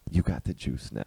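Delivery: low, soft murmur with a laugh behind it; mock dying words. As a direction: lean in and murmur it low, as a joke. Imagined dying man leaning over.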